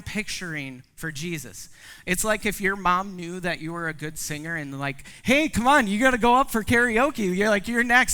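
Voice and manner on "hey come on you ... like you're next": high, loud eager mom urging; mother urging her son up to karaoke, high and loud; pushy mom. Retold or imitated. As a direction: cheer him on like an eager mother.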